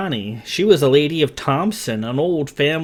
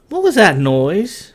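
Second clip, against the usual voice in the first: startled question; 7 semitones higher, 4 dB louder than usual. A narrator's reading. Morgan startled by sound.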